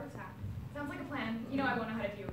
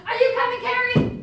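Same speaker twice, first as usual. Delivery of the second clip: loud high call across the room; 13 semitones higher, 16 dB louder than usual. Acted. Actor calling to a castmate across the stage, loud high call. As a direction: shout it to someone offstage.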